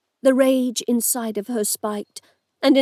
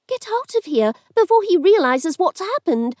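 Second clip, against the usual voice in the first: urgent, higher-pitched snap; snapped order; 7 semitones higher than usual. A narrator's reading. Cindy orders the woman out before he recovers, snapping. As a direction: snap at her to flee. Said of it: "Cindy snapped at her".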